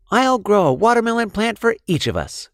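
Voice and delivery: lightly voiced eager girl; Lily promising. A narrator's reading. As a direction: promise it brightly, high. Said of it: "she said".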